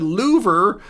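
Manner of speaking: "louver" with an oo in place of short i; mispronounced liver. Acted. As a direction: say "louver", LOO-ver, for liver. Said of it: "when they meant liver".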